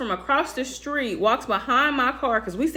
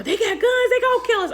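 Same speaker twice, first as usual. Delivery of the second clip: put-on panicked kids; 11 semitones higher than usual. Kids running, scared.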